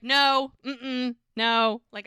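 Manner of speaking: loud, high no no no; an emphatic, shouted no; loud refusal. Retold or imitated. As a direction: shut it down loudly, high. Bree refusing to hear this.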